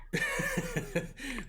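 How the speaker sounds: high-pitched giggling fit; laugh. Real, not acted. Game master laughs at player's interjection.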